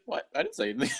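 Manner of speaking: squeaked very high, mock innocence; squeaky fake innocence. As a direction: deny it in a silly squeak. Mina denies speaking.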